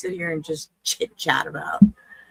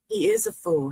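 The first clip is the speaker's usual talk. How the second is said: mocking. Real, not acted. Mocking the man in clip.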